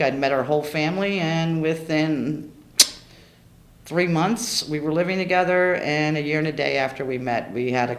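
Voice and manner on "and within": "within" stretched slowly for effect; drawn-out "and within" building suspense. Real, not acted.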